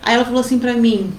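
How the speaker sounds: ordinary talk, nothing marked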